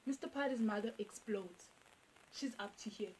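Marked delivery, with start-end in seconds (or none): none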